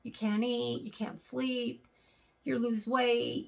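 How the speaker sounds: ordinary talk, nothing marked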